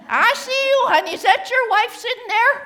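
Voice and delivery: loud, high-pitched teasing call-out; mock scolding. Calls out a staring audience member.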